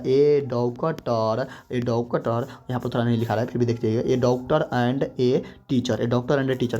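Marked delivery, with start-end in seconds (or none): 0.0-1.5 very slow, drawn out; stretched classroom example; slow dictation. Shown as a demonstration. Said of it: "जैसे कहेगा". 4.1-6.0 very slow, word by word; drawn-out classroom example; slow dictation. Shown as a demonstration. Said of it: "कह कर देखिए".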